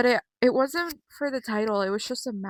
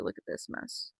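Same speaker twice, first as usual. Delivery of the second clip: whisper; 12 dB quieter than usual. Real, not acted. Whispered about her hair.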